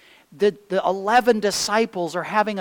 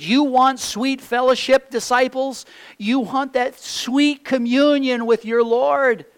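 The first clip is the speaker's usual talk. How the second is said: higher and louder, fervent preaching; fervent, raised pulpit call; rousing call; 8 semitones higher, 6 dB louder than usual. Acted. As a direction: lift the room with an eager question. Preacher calling out to disciples.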